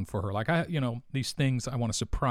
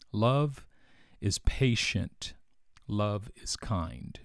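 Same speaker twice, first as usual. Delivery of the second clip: slow scripture reading; much slower than usual. Acted. Reading scripture verse aloud.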